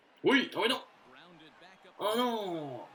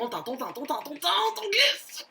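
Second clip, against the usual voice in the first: rising higher and louder to a near yell; excited building call; 12 semitones higher, 6 dB louder than usual. Real, not acted. Gamer calling his runner's slide, climbing to a shout. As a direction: call it like a commentator getting carried away.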